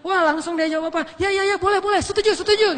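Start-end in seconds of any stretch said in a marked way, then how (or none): 1.2-2.8 higher, louder, tumbling eager agreement; eager yes. Retold or imitated. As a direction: blurt it out, thrilled at the offer. Mother eagerly agreeing to marriage.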